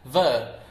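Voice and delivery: VER with no R, like "vuh"; flattened British VER; flat British ver. Shown as a demonstration. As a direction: drop the R from VER, British style. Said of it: "They make it really flat".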